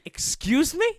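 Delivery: high, loud disbelief; taken aback. Retold or imitated. Customer to cashier about the price, taken aback.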